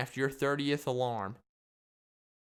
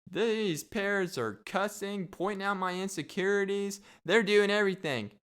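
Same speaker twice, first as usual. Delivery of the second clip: outraged visitor; 6 semitones higher than usual. Acted. Visitor complaining about cussing parrots, mock outrage. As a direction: complain like a scandalized park visitor. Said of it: "everybody would be like".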